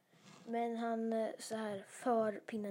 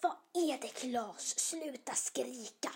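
woken mother; 3 semitones higher, slower than usual. Acted. Mum wakes and tells screaming Klas off, exasperated shout. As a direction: snap at a noisy child like a woken mum. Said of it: "hans mamma vaknar och bara".

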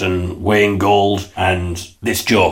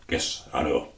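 very soft, lower meek reply; Ray's quiet voice; 5 semitones lower, 11 dB quieter than usual. Acted. Ray meekly agreeing with David, very soft. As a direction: answer meekly and softly.

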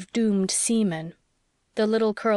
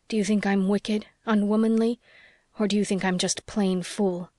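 usual speaking voice

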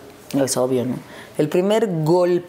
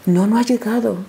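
higher put-on voice for the housekeeper; imitated housekeeper; 4 semitones higher than usual. Housekeeper answering the wife's call about her husband, imitated. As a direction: lightly imitate the housekeeper reporting back. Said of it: "Rufi, que todavía vive con él".

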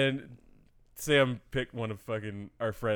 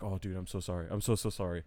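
apologetic; 5 semitones lower, 9 dB quieter than usual. Retold or imitated. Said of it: "he was so apologetic".

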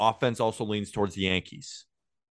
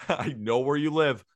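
creepy stalker line breaking into laughter; laughing creepy threat; 4 semitones higher than usual. Pretend. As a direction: try to stay creepy but crack up.

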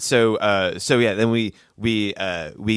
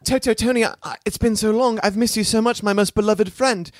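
higher, gushing Amadeus voice; overjoyed to see his long-lost friend, hammed up; Amadeus greeting friend; 11 semitones higher than usual. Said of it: "Amadeus is like".

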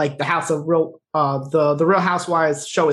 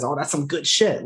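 ordinary delivery

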